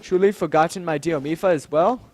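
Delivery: usual speaking voice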